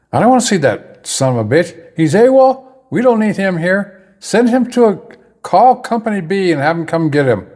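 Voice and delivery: high-pitched mock angry rant; retold yelling. Battalion commander overheard rejecting private, retold yelling. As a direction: imitate a commander yelling orders from his office. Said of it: "I can hear him yelling in there".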